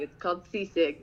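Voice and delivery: ordinary talk, nothing marked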